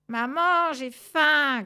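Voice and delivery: loud, high hungry chick; interrupting chick. A narrator's reading. Hungry chick interrupts the cats, loud and high. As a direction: cut in with a high, loud whine for food. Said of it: "coupa le poussin".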